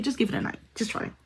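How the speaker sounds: hushed, gentle encouragement; soft gentle urging. Real, not acted. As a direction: coax softly.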